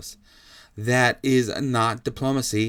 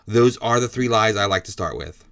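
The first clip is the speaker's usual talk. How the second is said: normal talking voice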